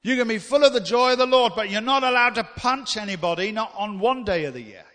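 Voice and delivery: loud, high, ringing preacher voice; emphatic sermon peak; preaching at full force. Real, not acted. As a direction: drive it home to the congregation. Preacher building to a climax.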